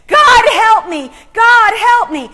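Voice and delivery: shouted high and loud, a desperate cry for help; retold scream. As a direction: cry out like a woman in a panic.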